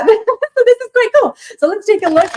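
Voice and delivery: high delighted laugh. Real, not acted. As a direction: laugh through the words. Shares a Leo partner.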